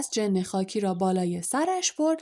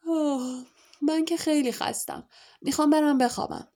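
sighing, higher-pitched tired complaint; acted sleepy groan, pitched up; yawning; 6 semitones higher than usual. A narrator's reading. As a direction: yawn through the words, mildly acted.

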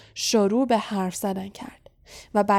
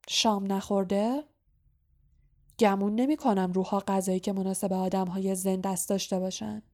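ordinary delivery

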